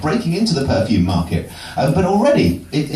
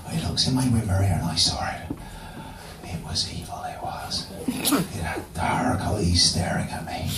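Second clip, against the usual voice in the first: spooky whisper; 8 semitones lower, 8 dB quieter than usual. Pretend. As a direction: breathe it like a campfire horror tale. Spooky tale told in whisper.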